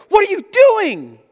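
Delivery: high, loud disbelieving cry; not a question but a rebuke; parent's alarmed what-are-you-doing; exasperated exclamation. Shown as a demonstration. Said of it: "That's not a question".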